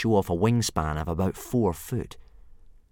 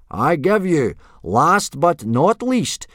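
loud, slow, high announcer's bellow; forced-enthusiasm yell; 10 semitones higher, 9 dB louder, slower than usual. A narrator's reading. Instructor presenting the last boy, yelling with forced enthusiasm. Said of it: "trying to put a bit of enthusiasm into his yelling".

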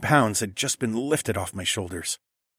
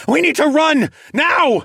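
high, loud urgent cry; urgent shout; 8 dB louder than usual. A narrator's reading. Narrator shouting to the girls to run now. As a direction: shout the warning while running. Fleeing a flying dino.

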